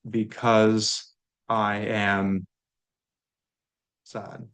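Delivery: very slow, drawn out, trailing to a whisper on sad; slow model sentence. Shown as a demonstration. Teacher models the answer slowly for the class. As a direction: say it slowly for learners. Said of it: "If we wanted to say".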